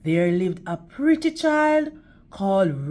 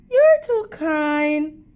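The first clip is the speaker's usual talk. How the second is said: higher, slow sweet thanks; girl thanking the wolf, a bit higher and slower; polite little girl; 6 semitones higher, 4 dB louder, slower than usual. Acted. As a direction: thank him like a trusting child.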